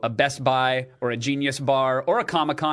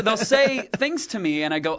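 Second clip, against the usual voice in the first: normal talking voice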